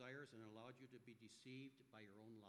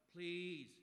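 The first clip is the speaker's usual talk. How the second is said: an urgent plea, louder; pleading; 4 semitones higher, 11 dB louder than usual. A narrator's reading. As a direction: beg for mercy. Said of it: "Morgan pleaded".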